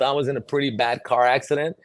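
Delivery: ordinary talk, nothing marked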